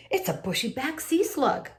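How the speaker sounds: name the creature with mild alarm; light attendant voice. A narrator's reading. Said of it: "said the other".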